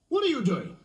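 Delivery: high-pitched, accusing demand; challenging park keeper. Acted. Park keeper confronting a drunk by the lake, sharp challenge. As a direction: challenge a culprit caught in the act.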